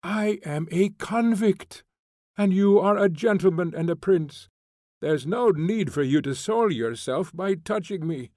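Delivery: loud, high, bitter scorn; a convict hurling contempt at a prince; furious tirade. A narrator's reading. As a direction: lash him with every word.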